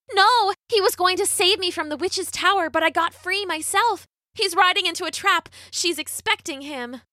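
put-on high young heroine voice, urgent; high, pleading storybook girl; anxious Rapunzel. A narrator's reading. Rapunzel urgently warns villagers.

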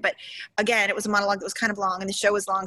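normal talking voice

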